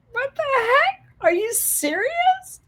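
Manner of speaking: high, crying what-the-heck; tearful disbelief. Retold or imitated. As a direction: squeak it out through tears. Retold tearful shock.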